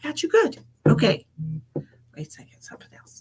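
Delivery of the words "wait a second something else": whisper. Real, not acted. Aside while pausing the stream.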